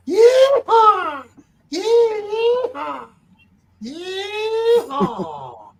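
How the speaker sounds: whooping high rodeo cry; cowboy yeehaw. Pretend. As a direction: holler yeehaw like a cowboy riding the bomb. Major Kong cowboy cry.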